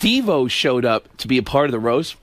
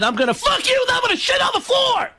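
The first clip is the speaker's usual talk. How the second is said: much higher, screaming Kinison rant; Sam Kinison impression. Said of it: "I bent her over and I said".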